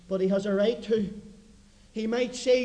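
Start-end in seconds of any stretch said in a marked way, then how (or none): none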